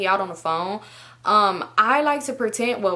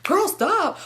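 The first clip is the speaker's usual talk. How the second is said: playful protest; 11 semitones higher than usual. Retold or imitated. Fending off friend during the call.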